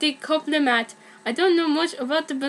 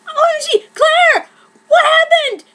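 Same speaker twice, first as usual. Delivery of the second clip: put-on alarmed boyfriend; 14 semitones higher, 9 dB louder than usual. Kurt bursts in alarmed.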